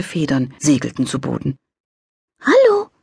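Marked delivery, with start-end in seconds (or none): 2.4-2.9 high, tender little angel voice; cooing angel voice. A narrator's reading. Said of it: "säuselte das Wesen mit zarter, hoher Stimme".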